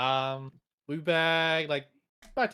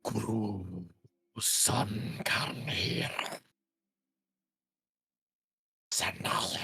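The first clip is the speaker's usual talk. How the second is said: slow, unvoiced ghostly whisper; eerie whisper; 5 semitones lower, much slower than usual. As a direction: breathe it like a spirit in your head. Eerie voice speaks into soul.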